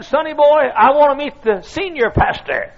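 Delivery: put-on high, loud, insistent old-timer; squeaky old voice. Old preacher putting a young pastor straight, squeaky. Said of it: "In a squeaky old voice".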